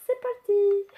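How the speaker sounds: high, loud, badly faked English accent; attempted English accent. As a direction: call it out in a mock English accent. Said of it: "c'est pas du tout l'accent anglais". (accent anglais raté, haut et fort)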